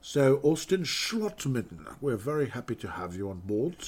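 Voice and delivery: mock British accent, slow and drawn out; a lightly put-on British announcer accent. Host hams up a British accent reading the signing. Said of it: "It's more of a British approach".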